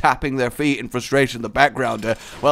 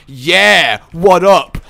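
mock street greeting; 7 dB louder, much slower than usual. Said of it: "my extreme whiteness".

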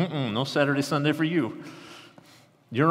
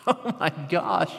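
slow, high laughing gasp of delight; 6 semitones higher, slower than usual. Real, not acted. Preacher laughing with joy at the congregation. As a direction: laugh and let it sink in. Laughs imagining walking with God.

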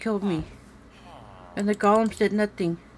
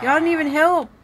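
indignant complaint; 7 semitones higher, 5 dB louder than usual. Real, not acted. Complaining at idle golems.

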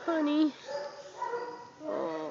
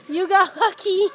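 laughing cheer; 5 semitones higher, 10 dB louder than usual. Real, not acted. Rescuer repeating to the dog through a laugh. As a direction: still loud, a laugh breaking in mid-word.

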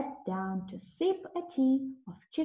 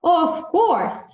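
eager animal voices; 7 semitones higher, 10 dB louder than usual. A narrator's reading. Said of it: "They all said".

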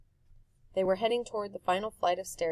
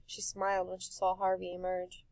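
ordinary delivery

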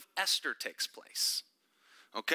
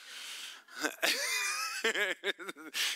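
breathy laugh; 6 semitones higher than usual. Real, not acted. Teacher amused by own point.

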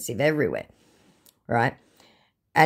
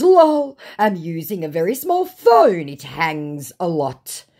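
put-on voice, loud start, slowing down; mock the scammer's excuse, drawn out at the end; acted-out scammer message; 4 semitones higher, 6 dB louder, slower than usual.

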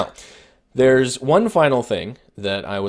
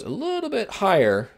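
voice going higher; 12 semitones higher than usual. Shown as a demonstration. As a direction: lift the pitch sharply on the phrase.

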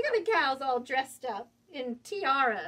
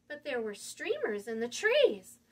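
ordinary delivery, nothing marked

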